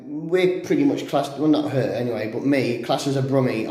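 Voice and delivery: ordinary delivery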